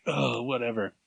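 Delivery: usual speaking voice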